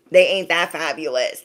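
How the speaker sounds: ordinary talk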